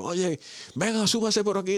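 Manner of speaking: very high, slow rescuer call; helicopter rescuer calling. Acted. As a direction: shout him up into the helicopter, pitched very high. Helicopter rescuer voiced in joke.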